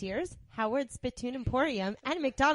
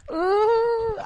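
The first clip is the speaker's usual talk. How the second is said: much higher swooning coo; high flirty ooh; 12 semitones higher, 7 dB louder than usual. Acted. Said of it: "sexy voice".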